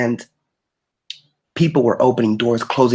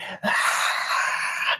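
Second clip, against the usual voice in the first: long voiceless mock scream; imitated scream; 6 dB quieter than usual. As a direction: act out screaming with only breath, no voice. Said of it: "and screaming and screaming".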